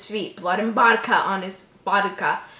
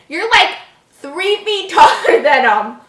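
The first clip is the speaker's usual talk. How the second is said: much louder and higher, ranting; exasperated rant; 5 semitones higher, 11 dB louder than usual. Real, not acted. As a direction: rant at the parents in disbelief. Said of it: "Why are none".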